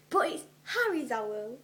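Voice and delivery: loud protesting cry; cried out. Acted. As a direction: Hermione crying out in shock. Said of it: "Hermione cried".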